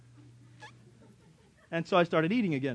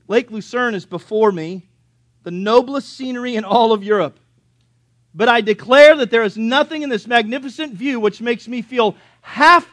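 louder, higher reading of a quotation; louder, elevated quotation read; quoted letter reading; 6 semitones higher, 13 dB louder than usual. Acted. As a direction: read the letter out with rising fervour.

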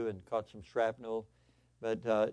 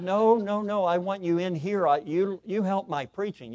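insistent reassurance; 7 semitones higher, 6 dB louder than usual. Retold or imitated. Son reassuring his dad, acted.

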